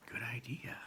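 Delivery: soft unvoiced aside; whisper. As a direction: breathe it almost silently.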